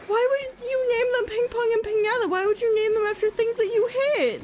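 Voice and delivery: high, wailing mock dismay; imitating distress. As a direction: act out appalled listeners in anguish. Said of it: "they sounded genuinely distressed".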